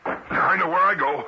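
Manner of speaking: threatening with the gun; tense defiance. Acted. Adams defiant as he raises the gun.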